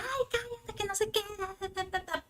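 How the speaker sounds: lightly hammed-up stressed mom; imitating herself scolding. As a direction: replay your stressed nagging in a high voice. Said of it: "yo le decía".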